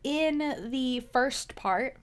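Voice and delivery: usual speaking voice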